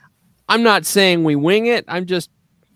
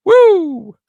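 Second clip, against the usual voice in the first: excited whoop; 13 semitones higher, 8 dB louder than usual. Real, not acted. Cheering after a joke.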